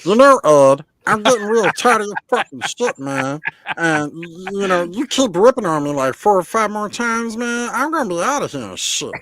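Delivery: tiny angry cartoon voice; Meatwad voice. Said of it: "I love that voice".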